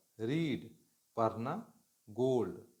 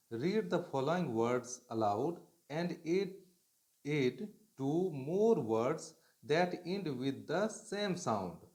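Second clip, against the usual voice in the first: slow dictation reading; 2 semitones higher, much slower than usual. Shown as a demonstration. Teacher reads English instruction.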